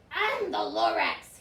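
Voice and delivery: lightly voiced coughing Lorax; a hint of a wheezy Lorax. A narrator's reading. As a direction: announce yourself slowly with a touch of wheeze. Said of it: "he coughed and he whiffed".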